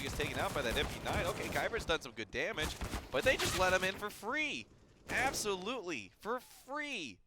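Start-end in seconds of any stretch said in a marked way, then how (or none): 3.1-7.2 high, pitchy sales pitch; infomercial voice. Acted. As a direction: sell it like a TV ad. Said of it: "I sound like an infomercial right now".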